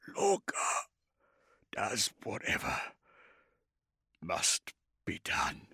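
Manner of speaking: soft, slow, rasping whisper; fading warrior, breathy and halting; growing fainter. A narrator's reading. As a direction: let the voice fail between phrases. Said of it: "He felt his voice growing fainter".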